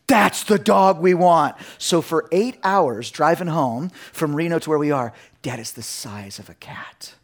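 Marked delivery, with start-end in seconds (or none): 0.1-1.6 put-on loud, slow pleading kids' voice; kids begging. Imitating his kids begging for the dog, loud and slow. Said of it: "my kids were like". 5.4-7.1 breathy whispered, much lower and softer; whispered kids' plea. Retold or imitated. Kids whispering to dad on the drive home.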